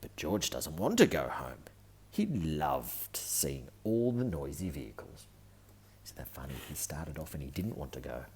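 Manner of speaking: plain reading voice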